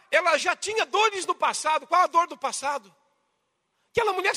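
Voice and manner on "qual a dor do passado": ordinary delivery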